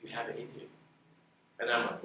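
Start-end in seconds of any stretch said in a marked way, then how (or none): 0.0-0.7 soft, hushed, barely above a murmur; quiet retold line. Mentor quietly reassuring a doubting young hairdresser, hushed. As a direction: say it quietly like a mentor's gentle nudge. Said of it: "he just quietly says".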